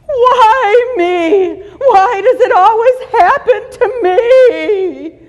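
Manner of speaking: high, loud, whining with a mock sob; whiny victim wail. To the audience, a hammed-up whiny victim. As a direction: wail it like a self-pitying victim. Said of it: "Did I do that pretty good?".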